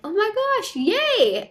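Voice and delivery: high, loud fake "yay" for a girl he didn't want; husband's hammed-up girl-reveal cheer; mock excited cheer. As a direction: cheer it up brightly while not meaning it. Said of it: "wasn't excited to have a girl".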